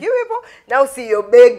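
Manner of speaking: loud, high-pitched mock jeer with a laugh; teasing. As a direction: imitate a dad loudly ribbing his children. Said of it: "my dad usually teases us".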